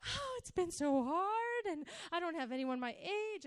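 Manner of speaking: hammed-up tearful complaint; mock crying. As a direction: whimper it like a teen bursting into tears. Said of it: "I burst into tears".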